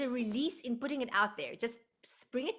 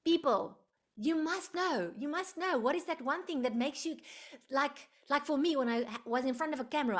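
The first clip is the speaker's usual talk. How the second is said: normal talking voice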